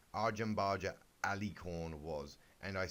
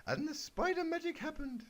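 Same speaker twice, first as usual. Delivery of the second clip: narration read very high; squeaky character voice by mistake. Shown as a demonstration. Narrating the kid's story, accidentally still in a high squeak. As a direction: slip into the pony squeak. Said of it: "Oh, wait".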